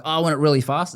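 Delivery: put-on a playful spoiled-child plea; mock whiny demand. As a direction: whine it like an impatient kid. Mock impatient wish.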